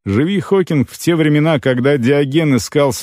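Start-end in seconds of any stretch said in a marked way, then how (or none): none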